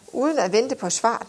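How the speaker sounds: usual speaking voice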